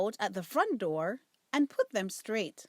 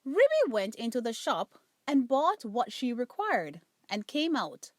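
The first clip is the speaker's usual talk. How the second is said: plain reading voice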